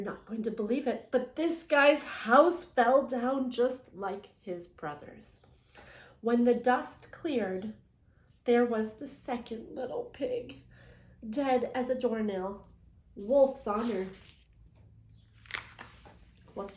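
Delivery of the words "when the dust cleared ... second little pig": ordinary delivery, nothing marked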